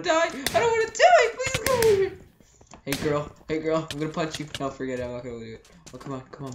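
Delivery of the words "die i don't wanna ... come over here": panicked high shriek. Real, not acted. Panicking while chased in game.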